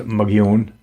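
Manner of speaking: Mogollon said "muh-gee-OWN"; correct local pronunciation. Shown as a demonstration. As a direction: say Mogollon with no L sound, "muh-ghee-OHN". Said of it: "but it's pronounced".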